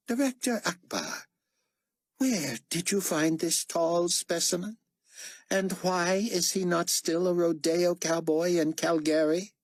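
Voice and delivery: high-pitched, haughty interrogation; disdainful potentate. Acted. Carpathia mocks the new man.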